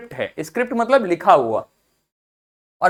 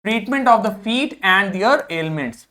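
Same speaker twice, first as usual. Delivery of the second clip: slow dictation; much slower than usual. Shown as a demonstration. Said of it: "reads definition slowly".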